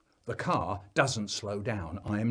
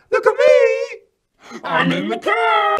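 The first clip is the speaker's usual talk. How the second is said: put-on very high, drawn-out toddler yell; calling out; 14 dB louder, slower than usual. Three-year-old shouting from the car window, gleeful yell. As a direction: shriek it proudly at full volume. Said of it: "looked out the window and called out".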